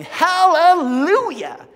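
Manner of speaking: loud, high, drawn-out joyful shout; shouting hallelujah. Retold or imitated. The redeemed shouting as the angels sing, loud joyful cry. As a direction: let it ring out in triumph. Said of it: "we start shouting".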